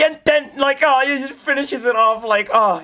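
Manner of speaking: high, loud awed rush; excited. Real, not acted. Streamer describing Rengoku finishing the demon, excited. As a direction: gush about the finishing blow. Hyped recounting.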